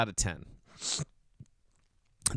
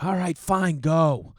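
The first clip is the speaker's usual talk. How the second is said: exasperated; 5 semitones higher, 4 dB louder, slower than usual. Retold or imitated. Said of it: "And I was like".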